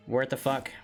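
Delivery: normal talking voice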